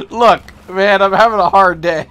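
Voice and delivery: laughing loudly through the words. Real, not acted. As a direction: laugh it out, exasperated. Laughing after hitting ceiling.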